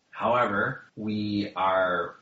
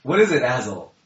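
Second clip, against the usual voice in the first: louder, lightly voiced dwarf; a hint of a gruff dwarf; Boothel voice; 4 semitones higher, 7 dB louder than usual. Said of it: "I will now be using the voice of Boothel".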